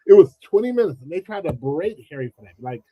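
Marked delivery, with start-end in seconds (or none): none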